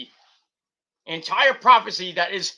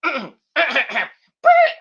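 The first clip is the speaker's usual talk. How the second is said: louder, higher attempt at "Burn it!" that cracks into a laugh; failed scream; 8 semitones higher, 9 dB louder than usual. Vlogger retrying the metal scream, laughing as voice gives. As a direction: try the scream again and break down laughing. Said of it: "I can't save it".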